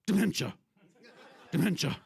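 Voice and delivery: sudden loud burst, word blurted and cut short; evasive doctor impression. Comic as doctor avoiding her eye while naming the side effect, evasive loud blurt. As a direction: imitate a doctor slipping the word out in a quick, explosive burst. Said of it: "he kind of lowers his head".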